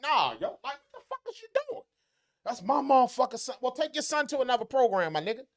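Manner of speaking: high-pitched, incredulous telling-off; indignant confrontation. Retold or imitated. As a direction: retell an outraged coach scolding a parent. Coaches confronting a parent, retold.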